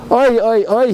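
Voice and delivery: high, loud anguished wail of oi; crying out oi. Retold or imitated. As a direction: cry out in dismay, pitched high and loud. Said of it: "he cried out".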